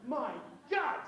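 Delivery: loud, high mock-American astonishment; American accent. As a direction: exclaim in a put-on American accent. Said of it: "said the American".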